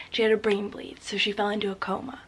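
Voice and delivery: ordinary delivery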